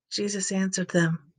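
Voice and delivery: ordinary delivery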